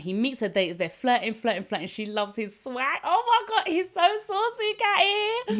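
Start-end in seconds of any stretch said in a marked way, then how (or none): 3.0-5.6 high-pitched girlish swooning, hammed-up; squealy put-on crush voice; mock gushing. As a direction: gush like a smitten fangirl. Voices the smitten girl gushing.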